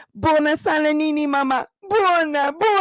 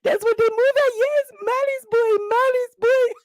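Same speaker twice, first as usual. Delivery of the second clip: higher, sing-song taunt; mocking villager; 8 semitones higher than usual. Villagers mocking Mali's boy, sing-song high.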